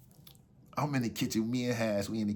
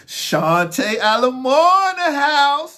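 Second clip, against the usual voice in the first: very high, loud, drawn-out entrance call; flamboyant announcement; 13 dB louder, much slower than usual. Retold or imitated. Runner announcing himself as he comes in the crib, flamboyant shout. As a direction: belt out a slow, showy arrival.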